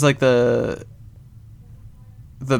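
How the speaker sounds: ordinary talk, nothing marked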